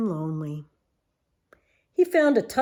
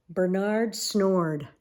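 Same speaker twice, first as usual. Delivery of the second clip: plain reading voice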